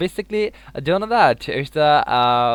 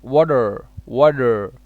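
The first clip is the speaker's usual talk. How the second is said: American accent; 5 dB louder, slower than usual. Shown as a demonstration. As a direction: say "water" the American way, t softened to d. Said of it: "American said that water".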